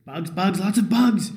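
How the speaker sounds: loud, rising cry of alarm; alarmed shouting. Acted. Player whose character is swarmed by centipedes, alarmed shouting.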